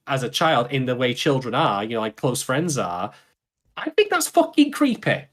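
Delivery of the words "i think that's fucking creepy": high-pitched, emphatic disgust; appalled. Real, not acted. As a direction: voice leaps up in indignant disbelief. Heated condemnation of character's act.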